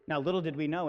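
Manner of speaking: usual speaking voice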